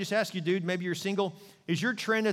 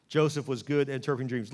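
usual speaking voice

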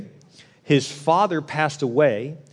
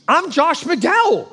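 much higher, slow, amazed; stunned; 13 semitones higher, 4 dB louder, much slower than usual. Retold or imitated. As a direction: say your own name in disbelief. Josh stunned on pier.

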